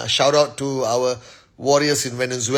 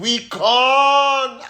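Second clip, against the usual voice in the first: excited shout; 12 semitones higher, 4 dB louder than usual. Real, not acted. Hyping upcoming VCon event.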